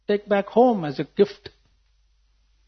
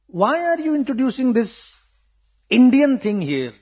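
higher-pitched, indignant challenge; retold challenge, raised pitch; challenging question; 7 semitones higher than usual. Locals challenge him in retold quote.

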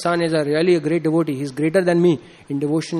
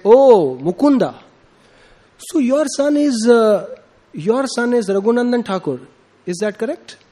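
lightly acted higher-pitched exclamation and query; exclaimed question; 6 semitones higher, 3 dB louder than usual. Chaitanya Mahaprabhu asks Mukunda about his son, lightly voiced exclamation. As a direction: call out, then ask with raised pitch.

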